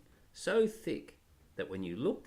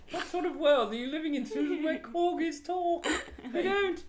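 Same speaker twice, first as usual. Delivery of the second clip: very high, fast, posh scorn through laughter; mock her like a haughty monarch; scornful royal; 14 semitones higher, 3 dB louder than usual. Father voicing Peter scolding Susan about corgis.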